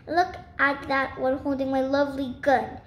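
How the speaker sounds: ordinary delivery, nothing marked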